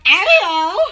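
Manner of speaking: a rising, half-laughing "ow"; lighter repeat of the ow. Retold or imitated. Retelling the fall to viewers, a rising, amused "ow". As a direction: shrug it off, amused now.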